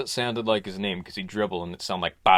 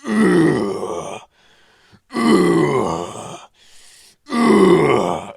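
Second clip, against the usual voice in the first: loud grunts rising higher each time; straining grunts; 6 semitones higher, 9 dB louder, much slower than usual. Acted. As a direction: strain harder with every rep. Grunts lifting weights.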